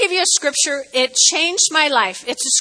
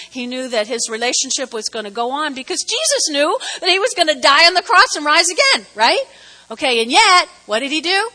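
normal talking voice

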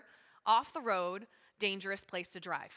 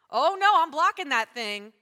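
loud, higher mock alarm: oh no; mock alarmed outcry; 3 semitones higher, 11 dB louder than usual. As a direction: act out blocking a creep online, alarmed. Acting out reaction to stranger.

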